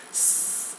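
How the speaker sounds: unvoiced hiss like air leaving a tire. Shown as a demonstration. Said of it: "letting the air out of a tire".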